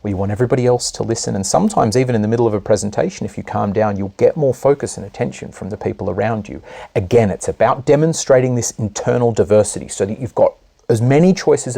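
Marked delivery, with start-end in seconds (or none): 0.0-6.6 calm it right down so they lean in; calm and quiet. Shown as a demonstration. Coach demonstrating a calm, softer voice to the room. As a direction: drop much softer and settle down. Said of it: "you want to calm down".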